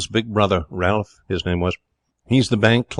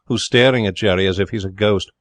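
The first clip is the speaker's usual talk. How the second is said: ordinary delivery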